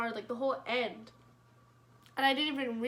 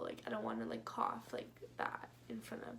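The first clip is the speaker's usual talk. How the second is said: embarrassed whisper; 11 semitones lower, 9 dB quieter than usual. Retold or imitated. Refusing to cough in front of people, embarrassed whisper. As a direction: drop to a breathy whisper, lower.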